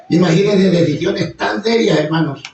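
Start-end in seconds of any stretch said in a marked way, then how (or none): none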